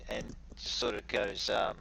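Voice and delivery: ordinary delivery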